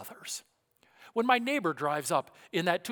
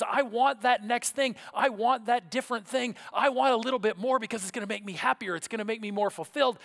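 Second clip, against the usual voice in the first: mock covetous voice; 7 semitones higher, 6 dB louder than usual. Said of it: "the primary motivation is".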